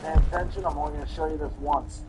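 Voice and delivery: loud, stern dad command; stern order. Acted. Frustrated dad yelling at his inattentive son, loud and stern. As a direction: snap him to attention, loud.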